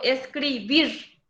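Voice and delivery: slow "escribir", stressed as escri-BIR; stress on last syllable. Shown as a demonstration. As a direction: draw it out and lean on BIR in "escribir". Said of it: "Cargo la voz en la última sílaba".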